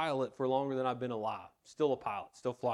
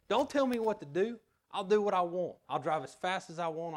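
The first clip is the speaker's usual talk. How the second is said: defiant retort; 5 semitones higher than usual. Pretend. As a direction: throw it back like a stubborn scoffer. Defiant scoffer talking back.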